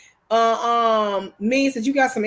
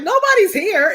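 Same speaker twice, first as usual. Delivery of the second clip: higher, louder insistent denial; defensive reply; 8 semitones higher, 6 dB louder than usual. Retold or imitated. Wife to suspicious boyfriend, louder insistent denial. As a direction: insist nobody's there, raised up. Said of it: "and I'm like".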